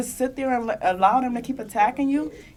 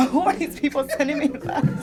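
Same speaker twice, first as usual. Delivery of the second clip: asked through laughter, higher; laughing question; 4 semitones higher than usual. Retold or imitated. Recalling asking her mom about letters, laughing.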